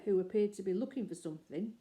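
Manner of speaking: ordinary delivery, nothing marked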